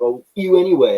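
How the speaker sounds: mock dismissive fan. Fans getting dismissive.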